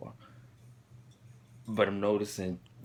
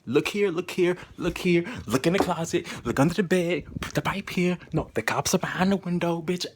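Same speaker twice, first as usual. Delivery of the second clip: higher, louder, frantic barrage of orders; tormenting voice; 6 semitones higher, 6 dB louder than usual. Retold or imitated. Hallucinated voice ordering the user round the room, frantic and loud. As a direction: imitate a nagging voice firing off urgent commands. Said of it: "to hear this voice saying".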